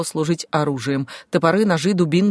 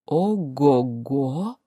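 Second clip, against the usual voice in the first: low, very drawn-out whoop; pirate chief's holler; 4 semitones lower, much slower than usual. A narrator's reading. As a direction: stretch it out low, like a gloating brigand. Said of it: "заорал их главарь". (протяжный возглас главаря пиратов)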